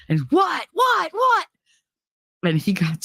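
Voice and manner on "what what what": put-on furious boy yelling what; angry kid yell. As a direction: snap back at the teasing kids. Joey yelling back, angry.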